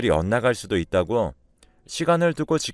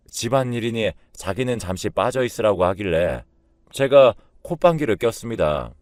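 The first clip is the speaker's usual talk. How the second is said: usual speaking voice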